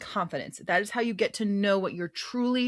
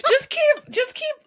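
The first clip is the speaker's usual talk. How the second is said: high squeaky teasing; 7 dB louder, slower than usual. Pretend.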